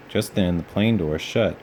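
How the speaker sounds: usual speaking voice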